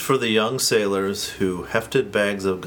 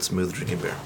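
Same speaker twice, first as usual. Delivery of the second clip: smooth ad read; 6 semitones lower, 7 dB quieter than usual. Retold or imitated. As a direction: savor it like a beer commercial. Reads label, then repeats it.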